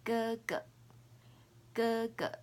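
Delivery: "ge ge" with a light staccato second; first "ge" full, second short; Mandarin gege. Shown as a demonstration. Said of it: "The pronunciation is ge ge".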